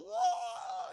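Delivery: very high, shouted monster howl; monster roar. Retold or imitated. Creature charging at the survivors, shouted howl.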